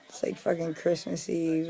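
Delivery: ordinary delivery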